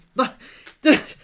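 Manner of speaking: loud, high laughing outburst; loud burst of laughter. Real, not acted. Outtake, cracking up at a late cue: loud laughter. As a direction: crack up loudly mid-take. Blooper laughter behind camera.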